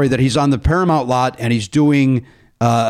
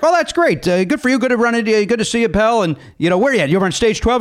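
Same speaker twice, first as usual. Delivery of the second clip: friendly Mel; 7 semitones higher, faster than usual. Retold or imitated. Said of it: "Mel's like".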